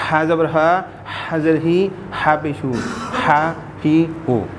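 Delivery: ہا pushed out on a strong stream of air; breathy ha. Shown as a demonstration. Teacher drills the letter with its vowels, airy ha. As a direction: drill each ہا as air from the throat. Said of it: "ہوا کی شکل".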